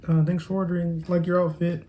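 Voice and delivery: high-pitched customer-service voice, imitated; lightly mocked high-pitched cashier voice; imitating the cashier. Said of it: "She was like".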